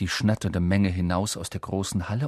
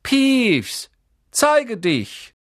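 high, loud summons; shouted command, far higher in pitch; raised voice; 12 semitones higher, 7 dB louder, much slower than usual. A narrator's reading. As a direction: call out sharply to a hidden troublemaker. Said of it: "Er hob seine Stimme".

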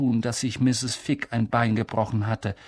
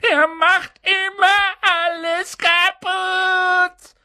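put-on high, slow, whining wail of a spoilt boy; fake-sobbing yell; 4 dB louder, much slower than usual. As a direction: howl it like a brat faking tears. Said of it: "schrie Dudley zwischen den markerschütternden falschen Schluchzern".

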